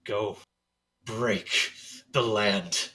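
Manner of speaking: spoken through gritted teeth. Acted. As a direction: force it out through clenched teeth. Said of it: "he says through very gritted teeth".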